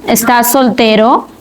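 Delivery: slow "Estás soltero", voice lifting on the last syllable of "soltero"; rising question intonation. Shown as a demonstration. Teacher repeating the example for students, "Estás soltero" with rising ending. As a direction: let "soltero" swing upward as a yes-or-no question. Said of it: "tiene una entonación ascendente".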